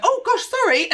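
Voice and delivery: high, startled little yelp of sorry; flustered, backing out of the room; startled apology. Retold or imitated. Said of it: "and I just went".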